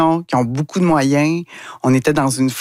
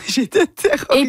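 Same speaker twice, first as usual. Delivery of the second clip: a laughing high-pitched repeat of terrorisée, going much higher; Quebec author laughing at her own fright, pitched up; 10 semitones higher than usual. Real, not acted.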